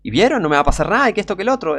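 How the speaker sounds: imitated smug bragging; mock arrogance. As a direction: mimic a cocky boaster taunting the crowd. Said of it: "Soberbia altísima el tipo".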